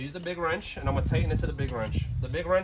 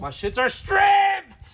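high, loud, drawn-out outcry; frustrated shout; 6 dB louder, much slower than usual. Real, not acted. Streamer finds his studs stripped, frustrated shout. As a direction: yell it out in real exasperation.

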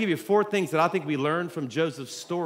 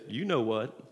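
sly punchline; 7 semitones lower, 6 dB quieter than usual. Acted.

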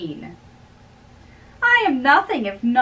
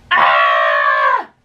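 very low, loud bellowing "Ah!"; a booming low yell of mock defeat; deep loud shout; 6 dB louder than usual. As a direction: let out a sudden deep roar. Cry in the banter.

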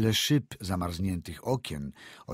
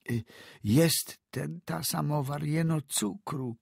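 put-on frightened old peasant woman; 8 semitones higher than usual. A narrator's reading. Old woman stammers to the doctor about the samovar, high and hesitant.

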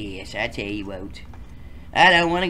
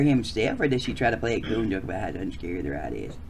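story narration; 6 semitones higher, 7 dB louder than usual. Acted. Reading game narration text.

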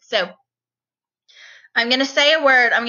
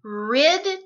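ordinary talk

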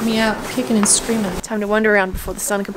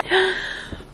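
sudden breathless gasp of delight; sharp gasp; 9 semitones higher, 4 dB quieter than usual. Real, not acted. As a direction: gasp in wonder. Raw reaction to the room.

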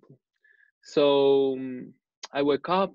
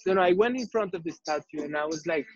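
normal talking voice

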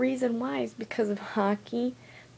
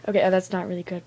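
normal talking voice

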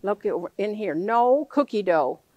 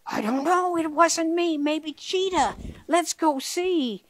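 high llama voice; llama puppet answering high; Llama's voice; 7 semitones higher than usual. Acted.

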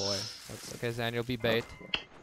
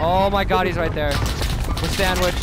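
panicking gamer. Real, not acted. Squad ambushed, teammates dying.

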